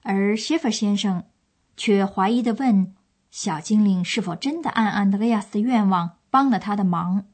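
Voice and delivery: ordinary delivery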